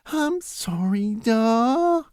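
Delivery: high, slow, small apology; sorry like a scolded child; high childish giant, slow and meek; meek giant son. Acted. Henry apologises.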